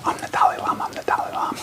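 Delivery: secret gloating whisper; teasing whispered self-praise; mock whisper. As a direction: whisper it to yourself in hiding. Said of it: "sneak into the bathroom and go".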